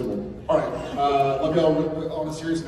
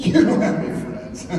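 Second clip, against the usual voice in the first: laughing, higher and louder, ribbing a friend; laughing tease; 14 semitones higher, 7 dB louder than usual. Real, not acted. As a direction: blurt it out through laughter. Teasing while laughing.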